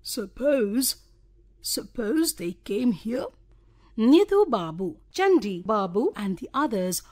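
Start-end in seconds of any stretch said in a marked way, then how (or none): 0.0-3.3 gentle. A narrator's reading. Bonku Babu timidly raising his idea, gently. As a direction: ask it gently, almost timidly. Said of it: "said gently".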